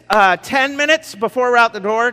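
rally the family out the door; hurried dad call. Acted. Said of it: "sounds maybe a little bit like this".

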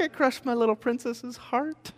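high, soft, pained lament; a touch of mock heartbreak; heartbroken dad. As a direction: mourn it like a guilty dad. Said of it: "I'm just like".

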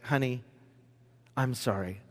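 soft, low, gentle apology; gently forgiving a crying daughter; tender apology. Retold or imitated. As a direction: say it softly to your little girl. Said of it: "I was just like".

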